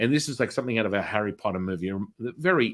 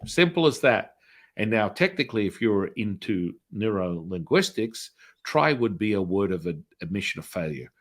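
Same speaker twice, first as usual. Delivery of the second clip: ordinary delivery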